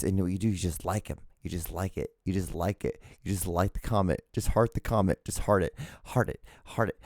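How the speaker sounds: soft, low, repeated little instruction; quietly chanting the same small action; hushed repetition. Shown as a demonstration. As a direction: drop the volume and repeat it like a quiet secret habit. Describes silently liking comments repeatedly.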